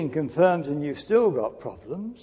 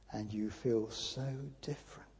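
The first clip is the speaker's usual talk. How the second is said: hushed whisper; 7 semitones lower, 12 dB quieter than usual. Real, not acted. Preacher whispering to his congregation about inner change, hushed. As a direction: whisper it, full of awe. Describing feeling after conversion.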